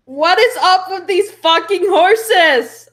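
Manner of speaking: slow, high, loud exasperated yell; drawn-out shout over the commotion. Acted. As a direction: holler it to get everyone's attention. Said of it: "I shout out".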